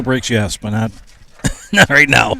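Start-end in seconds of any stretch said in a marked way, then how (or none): 1.3-2.4 laughing. Real, not acted. Laughing at his own joke.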